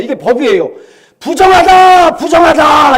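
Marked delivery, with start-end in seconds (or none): 1.2-3.0 loud, high cry of unclean; leper's warning shout. Retold or imitated. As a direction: shout a warning to keep people away. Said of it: "이렇게 소리를 질러야 돼요".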